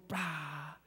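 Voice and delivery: low, soft "pa" sound effect; imitated shove. Storyteller showing how he got pushed, imitated impact. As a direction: drop low and puff out the impact.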